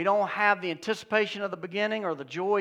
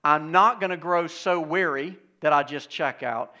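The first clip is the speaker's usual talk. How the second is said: firm refusal; 9 dB louder than usual. Shown as a demonstration. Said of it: "As you then say".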